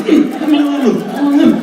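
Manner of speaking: high, greedy "mm" noises; pretend munching. Eating wings from the imaginary friend, hammed-up munching. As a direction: gobble with high-pitched mock delight.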